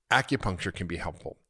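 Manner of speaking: ordinary talk, nothing marked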